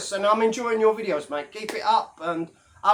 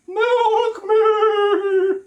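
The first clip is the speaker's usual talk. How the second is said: much higher, louder put-on voice; high comic cow cry; mock cow begging; 13 semitones higher, 6 dB louder than usual. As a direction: squeal it like a cow pleading to be milked. Cow udders joke, begging.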